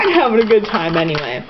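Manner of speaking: normal talking voice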